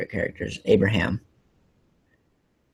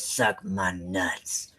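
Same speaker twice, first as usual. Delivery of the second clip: very slow, soft, defiant drawl; defiant Abraham line; 2 semitones lower, 9 dB quieter, much slower than usual. Retold or imitated. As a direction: deliver it with calm defiance. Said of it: "he goes".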